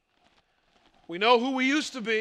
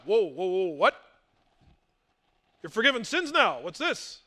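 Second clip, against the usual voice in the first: astonished crowd; 3 semitones lower than usual. Acted. Buzzing crowd reacts to Jesus.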